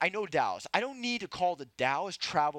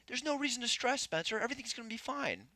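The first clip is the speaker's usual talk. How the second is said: higher, softer mock mother; mom's soothing voice; 9 semitones higher, 4 dB quieter than usual. Son imitating mom saying don't stress, higher and soft. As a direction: coo it like a mother calming a child. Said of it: "she always goes this".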